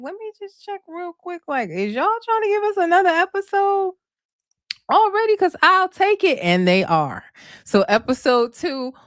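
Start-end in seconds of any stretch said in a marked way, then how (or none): 0.0-6.4 very high-pitched playful voice; eager self-quote. Retold or imitated. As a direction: act out an eager plea, pitched way up. Acts out her own eager checking.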